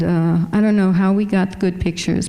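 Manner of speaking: ordinary talk, nothing marked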